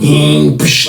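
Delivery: imitated slider whine, then a hiss; sliding door noise. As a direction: mimic a door whirring open with a whoosh.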